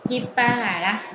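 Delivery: ordinary talk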